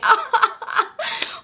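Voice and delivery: high squeal of laughter; a laughing squeal after the rude remark. Real, not acted. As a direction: burst into a shrill cackle. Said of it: "I'm laughing".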